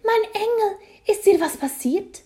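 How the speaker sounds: piercing high, loud mother's cry; shrill, fussing mother. A narrator's reading. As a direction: shriek it in motherly alarm. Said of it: "eine schrille Stimme".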